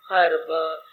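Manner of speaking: low, soft sigh to God; acted, sunken groan of complaint; weary lament. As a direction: sigh it out under the breath, as if worn out. Voicing a restless devotee's lament.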